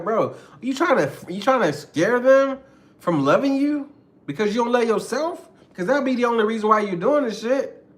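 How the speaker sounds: high, loud, challenging "bro" to an aggressive partner; confronting a partner. Retold or imitated. Speaker confronts aggressive partner, high loud challenge. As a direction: call them out, voice up and pushing.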